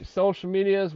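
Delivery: normal talking voice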